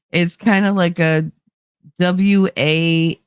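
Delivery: ordinary talk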